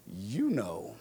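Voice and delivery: low, sly punchline with a swoop on the first word; knowing parrot. Acted. Parrot eyes the lady sideways, low and knowing. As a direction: drop it softly, as a shared secret.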